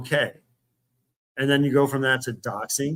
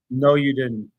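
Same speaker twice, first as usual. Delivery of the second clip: real, forceful contradiction; argumentative, emphatic denial; firm denial; 4 dB louder, slower than usual. Real, not acted. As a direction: shut the claim down firmly.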